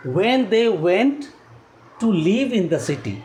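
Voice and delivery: very slow, word-by-word reading aloud; deliberate slow classroom reading; reading slowly. Shown as a demonstration. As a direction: read it out slowly for students to follow. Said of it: "मैं slow ही पढ़ रहा हूँ".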